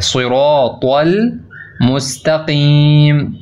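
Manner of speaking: सिरातल मुस्तकीम very slowly, heavy r and t, throaty q; drawn-out heavy recitation; heavy letters. Shown as a demonstration. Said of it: "रा मोटा है और फिर त्वा मोटा है".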